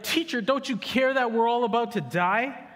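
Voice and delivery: high, loud, hammed-up panicky whine; mock panic. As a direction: pastor mocks the disciples' panic, hammed-up. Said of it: "They're like".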